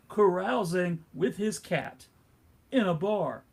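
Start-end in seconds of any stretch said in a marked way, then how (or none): none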